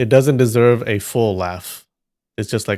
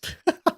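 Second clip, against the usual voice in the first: high squeaky laugh. Real, not acted. Laughing at the poll.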